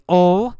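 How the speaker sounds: "ol" as one stressed syllable; reduced will. Shown as a demonstration. Teacher showing normal speech, "ol" for will. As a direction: say "ol" in place of will, held out. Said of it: "We say".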